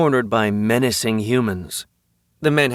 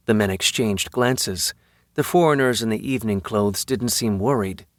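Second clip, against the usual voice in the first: usual speaking voice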